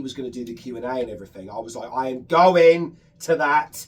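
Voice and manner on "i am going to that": GOING drawn out and loud; slow, loud declaration leaning hard on "going"; dead-set announcement, every word stretched; emphatic resolve. Retold or imitated. Recalled emphatic resolve on finding out.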